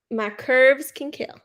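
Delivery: normal talking voice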